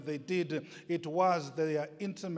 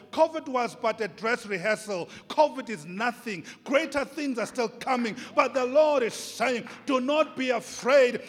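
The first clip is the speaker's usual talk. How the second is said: loud, higher, fervent sermon delivery; full-force preaching; 7 semitones higher, 9 dB louder than usual. Acted. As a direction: preach it out with rising fire.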